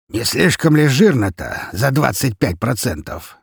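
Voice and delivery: drawn-out scornful indignation; sour objection. A narrator's reading. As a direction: balk at a greedy demand. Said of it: "скривился Гаврилович".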